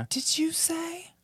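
high, soft ghost voice; ghostly whisper. Shown as a demonstration. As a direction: float it up like a faint ghost.

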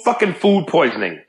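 ordinary delivery

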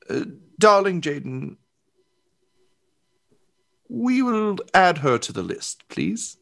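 high, sweetly menacing armor voice; icy queen. Acted. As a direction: purr the threat sweetly. Icy aside to groom.

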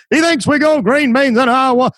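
mock the city boy like a laughing farmer. Farmers mocking the comedian.

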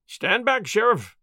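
sharp call to back off; crying out. A narrator's reading. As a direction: warn the sheriff off with a lifted voice. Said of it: "cried Little John".

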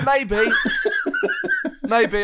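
normal talking voice

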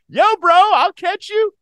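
very high, loud hollered mock voice; imitating Nick Swisher. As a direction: holler it out like an over-eager bro. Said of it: "Nick Swisher was like".

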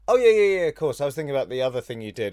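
high, loud, rushed gushing; fake enthusiasm. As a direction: bluff that you know their work. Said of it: "there's only so many times you can go".

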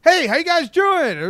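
very high, loud on-air greeting; put-on radio voice. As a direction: overdo a hyped radio hello. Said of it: "That's not how I talk".

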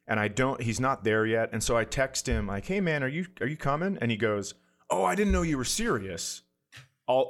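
ordinary delivery

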